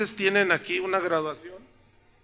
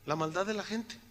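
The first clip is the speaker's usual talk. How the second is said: "La maldad de la gente" repeated much softer, sinking; softened echo of his own point; quiet repeat; 8 dB quieter than usual. Shown as a demonstration. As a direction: let "maldad" drop to a grave soft echo. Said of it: "es por la maldad de la gente".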